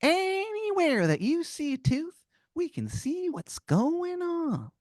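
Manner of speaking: high, slow, drawn-out tiny voice; high slow tooth-gangster voice; squeaky cartoon tooth. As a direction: make the gang's reach sound ominous, squeaky and slow. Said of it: "He goes".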